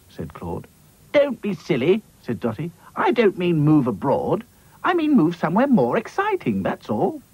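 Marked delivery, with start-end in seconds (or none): 1.1-7.2 Dotty's voice. Acted. Dotty explains.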